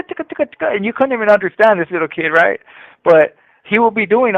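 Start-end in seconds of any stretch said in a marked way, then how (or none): 0.0-0.7 high playful ticka beat; toddler beat sounds. Retold or imitated. Dad mimicking toddler dancing to his own beat, high chirpy. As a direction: chirp the beat like a little kid. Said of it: "he'll be dancing at ticka".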